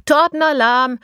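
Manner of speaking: high loud announcing shout; loud alarm call. Acted. Someone announces cake for all, loud alarm call.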